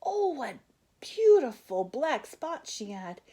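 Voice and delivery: high, awed exclamation over the spots; wide-eyed wonder; admiring gasp. A narrator's reading. Admiring exclamation in read story.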